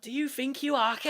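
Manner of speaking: mock-aggressive Liverpool accent; Scouse accent. Co-host pitches a Scouse shooter, confrontational Scouse accent. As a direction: challenge them in put-on Scouse. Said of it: "I want a Scouse game".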